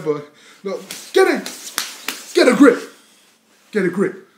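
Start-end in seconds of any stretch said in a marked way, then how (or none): 1.1-2.8 high, loud burst of self-scolding; shouted self-rebuke. Pretend. Rapper breaking off his confession, shouting at himself. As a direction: yell to pull yourself together.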